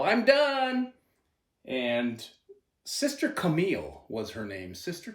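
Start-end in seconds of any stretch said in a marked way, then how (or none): 0.0-0.9 mock kid's yell. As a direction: imitate calling out proudly in class, pitched way up. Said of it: "I would just yell".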